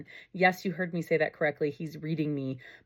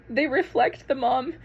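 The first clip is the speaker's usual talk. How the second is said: higher, slower, choked with emotion; getting emotional; 6 semitones higher, 3 dB louder, slower than usual. Real, not acted. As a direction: fight back tears, slowly. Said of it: "I told you I was gonna get emotional".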